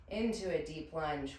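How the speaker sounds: ordinary talk, nothing marked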